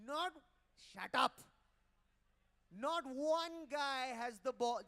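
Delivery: loud, slow taunt; comic calling out a silent crowd, loud and drawn out; mock shout. Real, not acted. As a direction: bellow it slowly at the crowd. Mocking silent audience.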